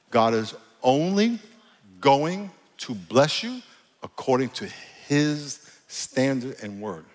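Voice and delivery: very slow, word by word; real slow. Shown as a demonstration. Preacher driving a point home to congregation, real slow. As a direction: spell it out slowly, pausing between phrases. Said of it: "I'm gonna say this real slow".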